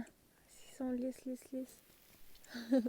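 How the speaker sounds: soft awed whisper. Real, not acted. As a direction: breathe the words, hushed. Touching smooth bark, whispering.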